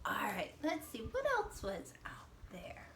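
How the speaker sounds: soft, half-whispered thinking aloud; hushed musing to young pupils; hushed wondering. Pretend. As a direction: ponder quietly as if searching your memory. Teacher wonders aloud to children.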